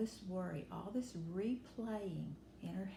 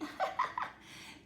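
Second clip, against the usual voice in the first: mocking high laugh. Girls laughing at her.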